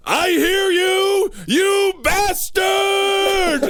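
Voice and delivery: drawn-out high yell, very slow; hammed-up furious shout up at the sky. Ed yelling at the voice from his yard. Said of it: "and yelled".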